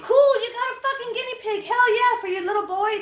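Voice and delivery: loud, high-pitched burst of excitement; all excited. Retold or imitated. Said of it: "I got all excited and shit".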